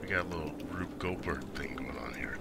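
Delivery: soft golf commentator whisper; hushed tones. Real, not acted. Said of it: "I'm speaking in hushed tones".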